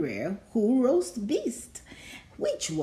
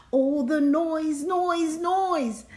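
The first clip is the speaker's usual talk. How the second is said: building noise; 7 semitones higher, slower than usual. A narrator's reading.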